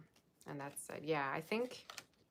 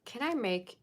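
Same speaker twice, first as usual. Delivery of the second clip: higher and louder, bursting out; excited outburst; 7 semitones higher, 6 dB louder than usual. Real, not acted. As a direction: blurt it out, thrilled. Reacting to huge plot twist.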